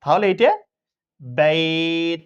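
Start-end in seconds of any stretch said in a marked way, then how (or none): 1.2-2.2 বেত very slow, the ei drawn out, the t closed; pausal stop with stretched vowel. Shown as a demonstration. Teacher demonstrates lin stretching on বেত. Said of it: "এভাবে উচ্চারণ হবে".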